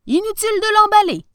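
high, loud, quick exclamation; delighted professor. A narrator's reading. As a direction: wave it off with delighted eagerness. Delighted professor answers the tailor.

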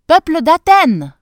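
loud, high call to the people; rally the whole harbour; shouting to the crowd. A narrator's reading. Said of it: "cria-t-il". (Thésée crie fort au peuple d'Athènes)

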